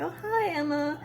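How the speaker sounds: loopy drowsy voice. Retold or imitated. Loopy girlfriend greeting after surgery.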